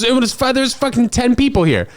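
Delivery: indignant rant about the heckler; outraged disbelief. Real, not acted.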